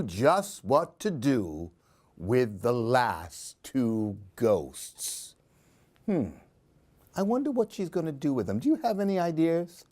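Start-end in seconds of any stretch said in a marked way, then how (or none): none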